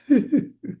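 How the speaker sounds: sudden high-pitched laugh; high burst of laughter. Real, not acted. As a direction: break into a real laugh. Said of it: "I can't stop laughing".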